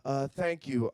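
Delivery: hesitant shy guy at a bar; timid orderer. Pretend. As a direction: meekly ask the bartender for a drink. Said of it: "all timid at the end of the bar".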